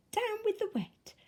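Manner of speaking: softly muttered, high and small; a grumbly little mutter. A narrator's reading. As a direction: mutter it under the breath. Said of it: "and they muttered".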